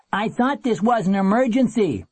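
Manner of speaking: man calling to someone outside a booth. A narrator's reading. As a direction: call it over as a complaint. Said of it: "the man called from the steps".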